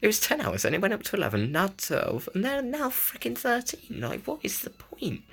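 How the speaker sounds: high-pitched, incredulous rant; exasperated disbelief. Real, not acted. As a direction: protest the absurdity in a high, strained pitch. Ranting at a pointless increase.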